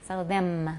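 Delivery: "them" ending on a closed m; final m. Shown as a demonstration. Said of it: "watch the M and them".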